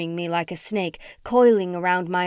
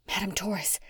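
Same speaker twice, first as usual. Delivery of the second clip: much softer breathy call of her name; whispered plea; 9 dB quieter than usual. A narrator's reading. Heroine whispering to her crystal ball, hushed. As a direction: barely voice it, reaching out. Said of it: "I whispered".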